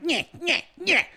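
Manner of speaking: high, taunting nyeh nyeh sounds; childish jeering noise; mocking nyeh. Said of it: "Just like".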